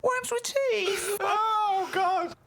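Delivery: very high, drawn-out squeal; helpless hilarity. Real, not acted. Host repeats guest's worm fact, squealing with hilarity. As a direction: squeal it slowly, overcome with amusement.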